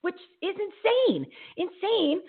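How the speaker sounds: high, slow outraged squeal; drawn-out high disbelief. Real, not acted. Outraged at obesity stats.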